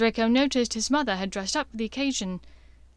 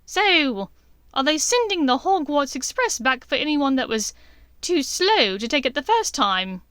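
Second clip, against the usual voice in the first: higher, loud sneering jab; mocking witch; 5 semitones higher, 6 dB louder than usual. A narrator's reading. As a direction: taunt the boy. Bellatrix mocking Draco.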